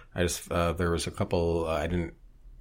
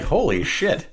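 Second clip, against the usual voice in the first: shocked exclaim; 10 semitones higher, 4 dB louder, slower than usual. Retold or imitated. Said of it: "And you're like".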